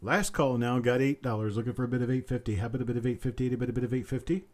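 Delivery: rapid auctioneer patter, looping the price; auctioneer bid calling. Acted. Auctioneer calling for bids from stream viewers, quick rolling patter. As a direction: rattle off the bid call like a sale barn auctioneer.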